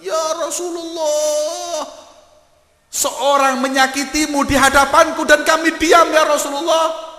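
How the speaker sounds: very high, loud, slow scream; anguished scream. Acted. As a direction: cry out in despair at the insult. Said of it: "teriak sahabat". (jeritan pilu yang sangat keras)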